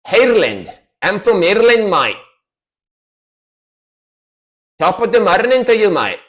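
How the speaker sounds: a hammy Irish greeting; mock Irish accent. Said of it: "how do they speak like".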